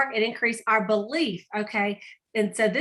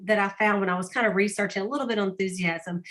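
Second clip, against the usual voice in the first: ordinary talk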